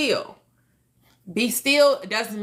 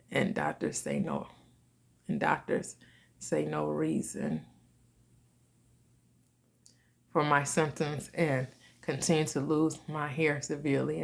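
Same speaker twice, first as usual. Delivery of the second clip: low, soft, halting read-out; reading aloud; 5 semitones lower, 7 dB quieter than usual. Real, not acted. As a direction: read the viewer's message quietly, with pauses. Reads out a viewer's sad message.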